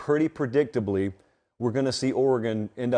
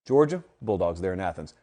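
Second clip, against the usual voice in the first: usual speaking voice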